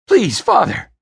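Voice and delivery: high, loud frightened plea; sharp high-pitched begging; pleading. Acted. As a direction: beg your father in alarm. Son begs his enraged father.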